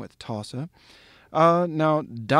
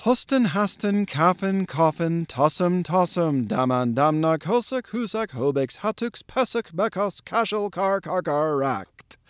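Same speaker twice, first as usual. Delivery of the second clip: all in one breath; 3 semitones higher than usual. Shown as a demonstration. Lecturer presenting thunder word number nine, all in one breath. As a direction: recite the whole chain evenly without stopping for air. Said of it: "get it all out in one breath".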